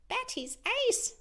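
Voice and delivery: very high, thrilled owl voice; excited owl. Acted. Pupil owl praising Miss Bats, very high. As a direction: gush about the teacher. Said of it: "said one of the owls".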